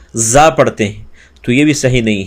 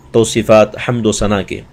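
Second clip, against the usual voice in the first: ordinary talk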